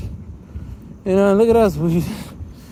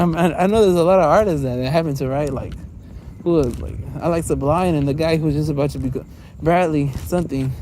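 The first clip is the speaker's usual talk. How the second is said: ordinary talk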